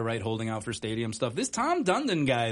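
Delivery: normal talking voice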